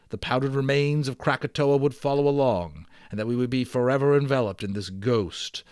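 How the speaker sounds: lively read-aloud storyteller; story narration. Acted. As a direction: tell the adventure with growing drama. Reads the adventure story aloud.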